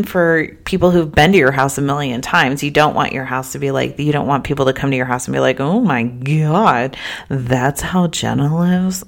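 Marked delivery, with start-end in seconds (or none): 5.6-9.0 slow, drawn-out mock horror; judgy, stretched-out disbelief; mock appalled guest. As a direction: imitate a scandalised visitor, dragging the words. Voices judgemental guests seeing her house.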